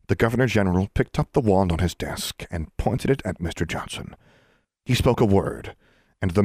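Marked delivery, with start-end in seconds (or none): none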